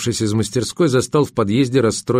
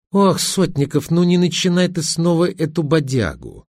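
theatrical exasperation; 7 semitones higher than usual. A narrator's reading. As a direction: groan it out like a stage tragedienne. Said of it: "трагично закатила глаза". (театрально-трагично)

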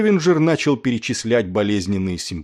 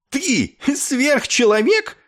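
high, drawn-out incredulous question; indignant disbelief; 4 dB louder, slower than usual. A narrator's reading. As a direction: throw it back in outrage. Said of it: "вскинулся Клевенджер". (высоко, с возмущённым изумлением)

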